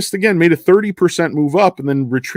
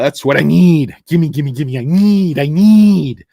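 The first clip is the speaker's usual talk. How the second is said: needy movie-quote voice, building louder; Bob Wiley; 4 semitones higher, 6 dB louder, slower than usual. Acted. Streamer quotes a film character begging, needy mock plea. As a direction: plead greedily, rising higher and louder on each need. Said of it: "to quote, uh, Bob Wiley".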